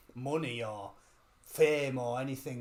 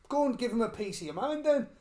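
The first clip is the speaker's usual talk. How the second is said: put-on egging-on old fella; 8 semitones higher, 4 dB louder than usual. Old fella urging her on.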